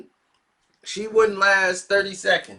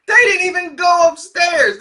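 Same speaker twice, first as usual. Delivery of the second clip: laughing shout; 11 semitones higher, 9 dB louder than usual. Real, not acted.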